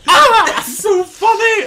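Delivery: laughing high and loud; shrieking laugh. Real, not acted. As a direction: squeal through laughter. Shrieking laughter over the scene.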